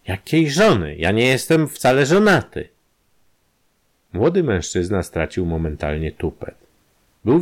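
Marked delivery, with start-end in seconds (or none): 0.1-2.7 indignant disbelief. Acted. Ballow indignant at blackmailer.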